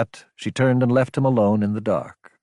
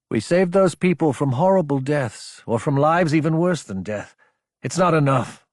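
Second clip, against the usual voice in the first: raised, insistent argument; impassioned; 6 semitones higher than usual. A narrator's reading. Officers arguing over those they saved, impassioned. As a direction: press the point at a high pitch.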